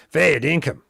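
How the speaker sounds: grunty, gravelly classic Aussie accent; gravelly Australian accent. Shown as a demonstration. Streamer showing off the morning mirror routine, gravelly Australian accent. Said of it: "needs that grunty, gravelly sort of thing".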